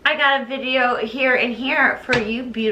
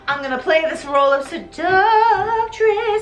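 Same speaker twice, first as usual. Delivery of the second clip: higher, drawn-out showy announcement; theatrical declaration; 5 semitones higher than usual. Retold or imitated. Retelling Christine taking the seductress role, theatrically drawn out. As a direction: stretch it out with mock grandeur. Acting out her chosen role.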